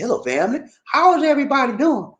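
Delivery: hammed-up character greeting. Shown as a demonstration. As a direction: greet everyone in a silly put-on voice. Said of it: "what kind of voice I just did just now".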